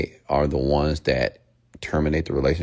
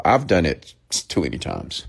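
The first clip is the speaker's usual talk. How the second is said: normal talking voice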